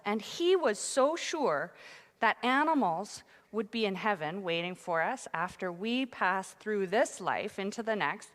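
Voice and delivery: ordinary talk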